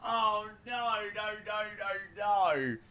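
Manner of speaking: high, whiny string of noes; mock despair. Real, not acted. Streamer denies finding a grey hair, mock despair. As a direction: moan in playful denial.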